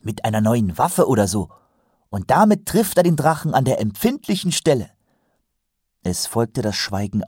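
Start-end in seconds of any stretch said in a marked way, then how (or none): 0.0-4.9 a higher-pitched, lightly voiced keen recruit; earnest young watchman, confident. A narrator's reading. Recruit assures colleagues a hero will appear, earnest confidence. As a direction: assert it with naive conviction.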